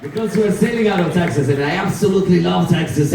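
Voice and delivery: booming show host hype; lucha libre announcer. Acted. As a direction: pump up the crowd like a ringside announcer. Cruise director welcomes the theater.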